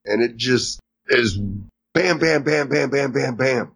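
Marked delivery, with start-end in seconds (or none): 1.9-3.7 bam after bam in an even, unbroken run; rapid-fire barrage. Shown as a demonstration. Recapper imitates a barrage of flashbacks, rapid-fire. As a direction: rattle them off like quick flashes.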